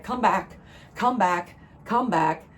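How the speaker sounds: loud repeated call, each "back" falling. Retold or imitated. Reader voicing spirit calling her back, loud call. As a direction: call someone home, loudly and insistently.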